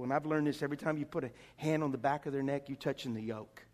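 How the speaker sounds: ordinary talk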